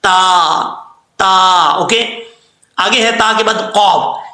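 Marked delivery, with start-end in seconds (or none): 0.0-1.7 ता said thin and light, tongue tip on teeth; crisp light ता. Shown as a demonstration. Teacher demonstrates the light ता sound. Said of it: "बारीक पढ़ा जाता है".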